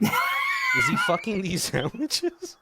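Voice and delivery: real incredulous laughing shout; incredulous outburst. Real, not acted. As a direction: shriek it in laughing disbelief.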